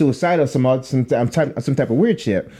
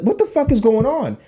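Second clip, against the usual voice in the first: higher-pitched, baffled exclamation; mock bewilderment; 8 semitones higher than usual. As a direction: act out waking up confused. Retelling his baffled waking up.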